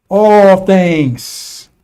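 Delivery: loud, long-held "All" falling away into "things"; stress on ALL. Real, not acted. Preacher summing up everything that will be destroyed, emphatic drawn-out "all". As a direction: boom out "All" and stretch it.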